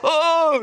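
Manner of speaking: loud, high gasp of shock; startled yelp. Acted. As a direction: cry out as if caught off guard. Acting someone startled by the angel. (깜짝 놀라 높고 크게 "어!")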